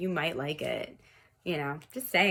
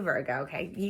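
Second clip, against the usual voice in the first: ordinary delivery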